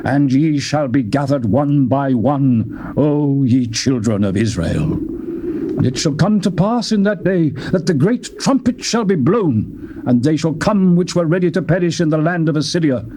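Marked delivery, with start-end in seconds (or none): none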